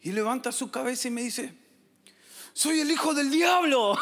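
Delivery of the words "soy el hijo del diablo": put-on menacing boy. Boy declares himself devil's son.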